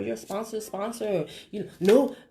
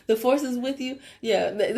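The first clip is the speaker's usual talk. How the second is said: ordinary talk, nothing marked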